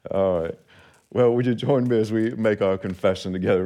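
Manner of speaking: usual speaking voice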